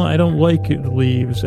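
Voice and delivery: usual speaking voice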